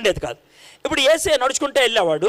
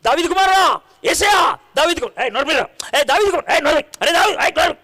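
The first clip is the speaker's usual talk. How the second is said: desperate shout; 3 semitones higher than usual. Acted. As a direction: yell over the crowd to be heard. Blind man shouting over the crowd.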